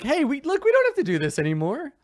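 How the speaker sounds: high-pitched, placating plea; Sir Bluto pleading. Acted. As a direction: talk them down in a high, anxious voice. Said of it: "he's basically telling you".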